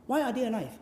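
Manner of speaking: ordinary talk, nothing marked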